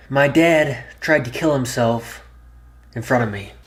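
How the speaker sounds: hooking the viewer with a bold opening statement; emphatic reveal. Shown as a demonstration. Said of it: "This is not clickbait at all".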